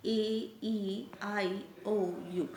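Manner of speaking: ए, ई, आई, ओ, यू each said very slowly and separately; vowels said slowly. Shown as a demonstration. Teacher listing vowels for children, very slowly. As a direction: stretch out each vowel name. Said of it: "ये क्या होते हैं?".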